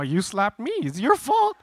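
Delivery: high-pitched blame; acted-out kid-brother protest; indignant brother. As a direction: throw it back, voice climbing. Acts brother throwing the blame back.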